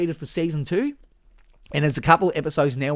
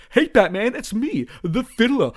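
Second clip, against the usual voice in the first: much higher, louder teasing villain; Fiddler voice; 8 semitones higher, 5 dB louder than usual. Retold or imitated. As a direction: taunt Batman in a much higher cheeky voice, laughing at the end. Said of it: "my favourite is ... the Fiddler".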